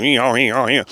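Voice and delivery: lightly mocked American twang. Vlogger showing how everyone started to sound, in a mock American twang. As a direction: imitate an exaggerated American twang. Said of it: "I hear that American twang now".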